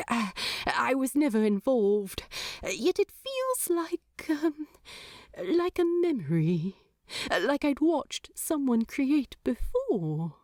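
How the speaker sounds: halting angel groping for a memory; a hint of the fussy angel, unsure; lightly voiced angel, hesitant. A narrator's reading. Aziraphale struggling to place a memory, hesitant.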